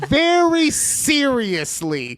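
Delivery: mock-offended rant. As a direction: stretch the key words in hammed-up outrage. Mock-offended rant acting out the horoscope.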